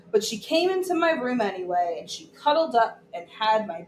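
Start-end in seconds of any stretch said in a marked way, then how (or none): none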